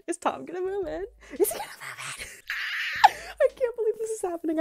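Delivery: high laughing squeals, rushing at the end; can't believe the luck; breathless shrieking delight; giddy disbelief. Real, not acted. Dream cat Tom arriving.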